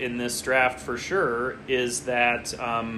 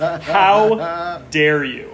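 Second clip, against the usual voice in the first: very slow, drawn-out mock indignation; hammed-up outrage; 3 semitones higher, 7 dB louder, much slower than usual. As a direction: stretch it out like a stage villain.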